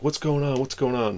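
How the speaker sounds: high, frantic repeated question; panicked mom. Retold or imitated. Mother, scared for her son at the arrest, asks in a high, frantic voice. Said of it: "my mom's freaking out".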